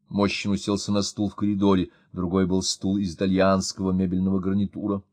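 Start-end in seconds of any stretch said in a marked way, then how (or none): none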